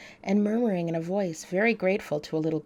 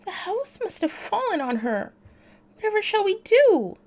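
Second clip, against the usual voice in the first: put-on much higher, panicky girl's voice, louder at the end; dismayed girl; 13 semitones higher, 4 dB louder than usual. A narrator's reading. Dorothy realizing the house fell on the witch, in dismay. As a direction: plead in alarm, not knowing what to do. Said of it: "clasping her hands together in dismay".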